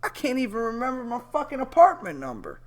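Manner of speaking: high, fast mock amazement; sarcastic awe. Real, not acted. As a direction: pile on the fake admiration. Sarcastic rant about remembering numbers.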